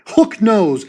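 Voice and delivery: ordinary delivery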